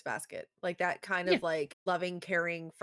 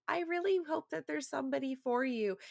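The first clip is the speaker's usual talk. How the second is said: high, sweetly acted girl voice; dying girl's earnest wish; 9 semitones higher than usual. Dying girl to the vampire, earnest. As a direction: offer a wistful wish. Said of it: "she's like".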